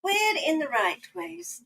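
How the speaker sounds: usual speaking voice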